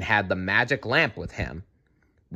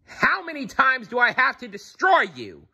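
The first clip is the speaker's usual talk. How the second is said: much higher, roaring villain voice; furious sorcerer bellowing in a put-on voice; 14 semitones higher than usual. A narrator's reading. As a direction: Jafar rages at the hero who keeps returning. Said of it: "he roared".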